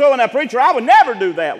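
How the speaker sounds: loud, high mock protest. Church member objecting to the preacher's warning, protesting.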